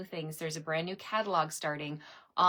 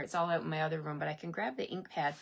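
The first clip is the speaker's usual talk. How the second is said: ordinary talk, nothing marked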